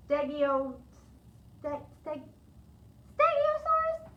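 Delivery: stumbling then triumphant. Acted. Amelia Bedelia stumbles on the dinosaur name, then blurts it high. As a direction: stammer the start, then pop the word out high. Stumbling over a hard word.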